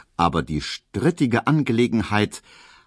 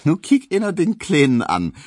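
higher, louder Berlin teasing, pity and a smile; half pitying, half amused; 11 semitones higher, 6 dB louder than usual. A narrator's reading. Hedwig teasing shy Emil, half pitying half amused. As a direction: poke fun at him half kindly. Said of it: "halb mitleidig, halb lächelnd".

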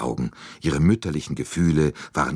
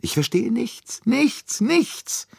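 very high, wailing nichts three times; despairing Bouc; slower than usual. A narrator's reading. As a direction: throw up your hands in despair. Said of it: "seine ganze Hoffnungslosigkeit verriet".

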